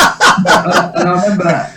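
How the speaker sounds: high laugh into speech. Real, not acted. Comedian laughing at his own teacher impression. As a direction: laugh at your own joke, then carry on.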